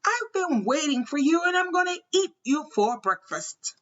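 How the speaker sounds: high-pitched storybook threat; troll screaming. A narrator's reading. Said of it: "The troll shook all over as he screamed".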